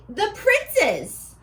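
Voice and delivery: ordinary talk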